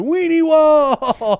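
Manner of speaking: drawn-out high yell, voice pushed up; screaming. Retold or imitated. Cousin screaming at a wall of vibrators, high mock scream. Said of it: "my cousin's just screaming".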